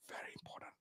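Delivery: unvoiced whisper, leaning in. Real, not acted. Vlogger stressing fresh water's importance, whispered. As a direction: whisper it like a secret.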